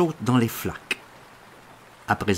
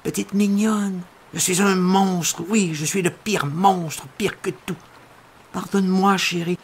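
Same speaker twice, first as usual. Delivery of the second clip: raised, begging voice; pleading; 8 semitones higher, 3 dB louder than usual. Retold or imitated. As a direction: plead with her, higher and louder. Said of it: "je la supplie".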